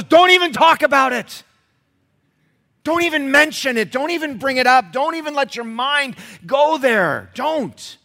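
very loud, high, ringing commands; full-force preaching. Acted. Preacher warning the church off sin, full-force preaching. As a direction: hammer each don't home.

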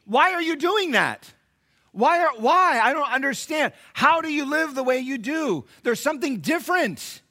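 high, loud bewildered questioning; puzzled onlooker. Retold or imitated. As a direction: play a baffled neighbor. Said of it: "people will ask you the question".

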